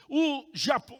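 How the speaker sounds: ordinary talk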